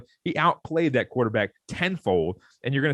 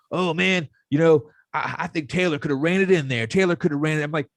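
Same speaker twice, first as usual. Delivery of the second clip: higher, whiny mock fan voice; mocking a know-it-all fan; 4 semitones higher than usual. Podcaster mocking Twitter fans, higher and whiny. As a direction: sneer it out like a clueless fan. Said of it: "everyone saying".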